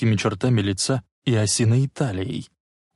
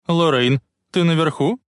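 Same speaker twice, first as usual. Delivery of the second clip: loud, high call up the stairs; 7 semitones higher, 4 dB louder than usual. A narrator's reading. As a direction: call up to another floor. Mother calling from downstairs.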